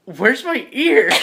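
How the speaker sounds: high, slow, drawn-out question; pleading, not angry. Acted. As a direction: wail it without anger. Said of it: "not angry, I'm just like".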